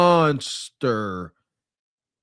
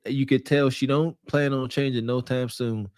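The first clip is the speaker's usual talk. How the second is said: normal talking voice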